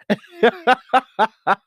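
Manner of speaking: loud, high real laugh; burst of laughter. Real, not acted. Laughing about how spectacularly she failed. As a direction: crack up at her own failure.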